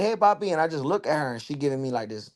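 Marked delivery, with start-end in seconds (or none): 0.0-0.5 greeting his son. Retold or imitated. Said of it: "and I'm like".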